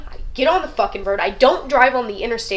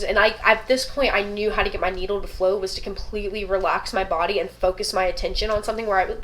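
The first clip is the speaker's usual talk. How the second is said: ordinary delivery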